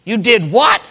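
high, loud startled "What? You did what?"; the stunned father can't believe his ears; shocked disbelief. Acted. Father hearing his sons' news, loud and shocked. Sons tell Jacob about Simeon.

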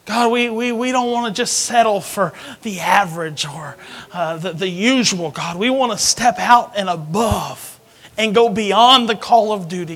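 loud, fervent pastor's prayer. Acted. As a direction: pray it over the congregation with fire. Leads congregation in prayer.

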